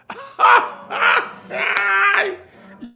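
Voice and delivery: loud high burst of laughter; big laugh. Real, not acted. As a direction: let a real laugh explode. Host cracks up at own story.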